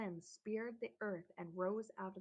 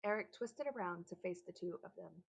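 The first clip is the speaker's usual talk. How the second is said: ordinary delivery, nothing marked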